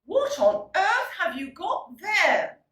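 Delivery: high, loud outraged exclamation; shocked parents. Acted. Parents see the old mongrel the children brought home, outraged. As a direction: parents bursting out in disbelief. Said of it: "said the parents".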